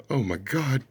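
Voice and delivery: ordinary talk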